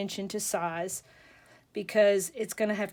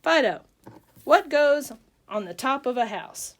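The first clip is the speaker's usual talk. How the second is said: loud, higher call to a pet; punchy, raised voice with falling calls; calling the dog; 4 semitones higher, 6 dB louder than usual. Acted. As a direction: act out summoning a dog to perform. Joke owner calling his dog.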